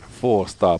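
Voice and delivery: ordinary talk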